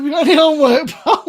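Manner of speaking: teasing question through laughter; mocking with a laugh. Mate teasing a friend on the game, laughing. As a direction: rib him like a teacher, laughing. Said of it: "I said".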